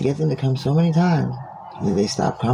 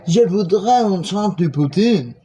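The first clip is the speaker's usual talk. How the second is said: higher French ordering voice; imitated customer ordering; 6 semitones higher than usual. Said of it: "you might accidentally say".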